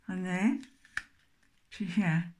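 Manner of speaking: card held against teeth; speaking around paper card; talking with card between teeth. Real, not acted. Seen 0: Card held between teeth against lips throughout the labelled words.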